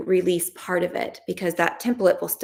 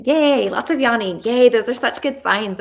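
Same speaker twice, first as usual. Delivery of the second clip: higher, louder, delighted cheer; 5 semitones higher, 6 dB louder than usual. Real, not acted. Coach reading the chat, cheering the viewers' results. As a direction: celebrate the good signs.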